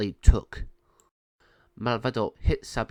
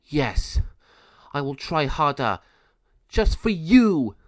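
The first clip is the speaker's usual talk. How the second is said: angry; 4 semitones higher, 5 dB louder, slower than usual. Acted. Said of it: "became very angry and said".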